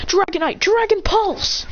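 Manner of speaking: high, stretched-out battle call; attack command. Acted. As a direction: draw out the move's name high. Trainer shouts attack command.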